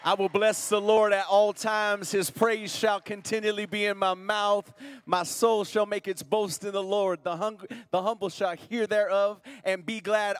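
loud, high, slow praise call; preaching at full force. Acted. Pastor calling the congregation to praise, full-force preaching. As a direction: rouse the church to praise the Lord.

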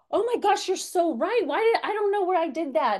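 high-pitched, flustered admission; mock-replay of a stricken "oh my gosh"; retold dismayed realization. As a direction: act out suddenly realizing you were wrong. Retold flustered apology to hurt daughter.

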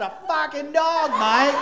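Australian punchline. Retold or imitated.

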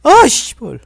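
loud high swear; angry curse shout. Real, not acted. Fails again.

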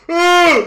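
high, very loud scream of fright; terrified scream. Real, not acted. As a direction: scream as the storm hits. Scared by storm in game. (grito de terror, agudo y fuerte)

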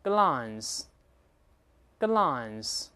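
slow British "glance" with an open ah vowel; British English. Shown as a demonstration. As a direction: drag out "glance" in British style. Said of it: "再来一遍". (英式发音慢读glance)

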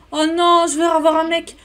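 oh non, plus aigu et plus fort, comme une Sim; prête sa voix au personnage, aiguë et forte. Acted. Joueuse double la Sim. (petite voix de Sim plus haute et plus forte)

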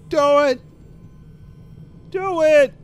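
loud high dare, do it; mock taunting shout. Gamer taunting the killer to finish him, loud mock dare. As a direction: goad the killer loudly.

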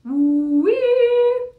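very high loud sung siren; sung siren wee; siren wail. Acted. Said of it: "Went a siren coming through".

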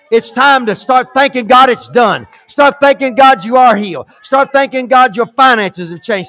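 loud, insistent exhortation; full-force preaching. Real, not acted. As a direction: urge the congregation on, phrase by phrase.

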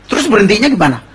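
ordinary talk

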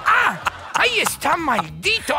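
hammed-up villain shout; villain discovery. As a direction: crow like a stage villain finding the hero.

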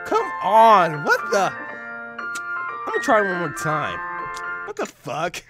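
ordinary delivery

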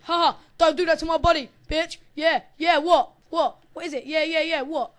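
imitating a gloating player yelling into the mic; mocking gamer taunt. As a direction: gloat and rub it in, shouting. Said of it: "he cries over the mic".

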